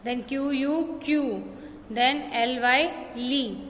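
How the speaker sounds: usual speaking voice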